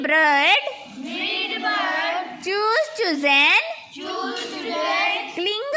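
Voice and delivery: slow, drawn-out teacher chant; measured classroom verb recitation; repeat-after-me drill. Shown as a demonstration. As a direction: stretch each word for the class to echo. Leads word repetition drill.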